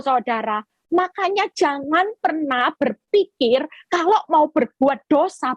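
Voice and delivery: normal talking voice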